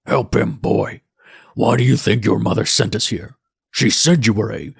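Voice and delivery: derisive snap. A narrator's reading. Patrick snapping at his former stepson, derisive. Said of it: "to snap at me".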